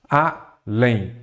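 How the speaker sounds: ALÉM split in two, a, lém; syllable by syllable. Shown as a demonstration. Teacher presenting an example word to students, syllable by syllable. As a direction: hold apart the syllables of ALÉM.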